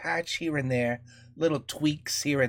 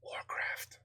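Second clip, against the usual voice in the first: breathy whisper of one word; a sly whispered jab; whispered aside; 14 dB quieter than usual. Pretend. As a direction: slip the name in as a secret. Muttered joke aside.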